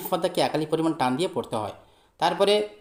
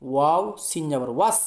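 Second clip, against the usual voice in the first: ordinary talk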